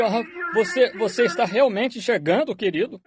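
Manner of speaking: high-pitched amazed question; astonished wife, lightly acted; retold astonishment. Wife amazed husband can see.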